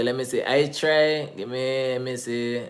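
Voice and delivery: normal talking voice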